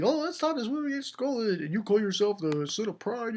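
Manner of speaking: high, quick put-on jeer; mock taunting. One playing kid mocking the Sin of Pride, high jeer.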